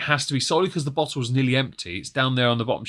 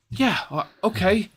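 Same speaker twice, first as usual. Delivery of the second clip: hesitant housemates; 8 semitones higher, slower than usual. Retold or imitated. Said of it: "And they're like".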